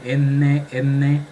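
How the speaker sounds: N repeated with a long, ringing nasal sound; resonant N. Shown as a demonstration. Teacher feeling for sinus resonance, N held and hummed. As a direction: lean on the nasal sound inside each N. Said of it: "Siento también una resonancia acá".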